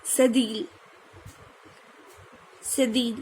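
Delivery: normal talking voice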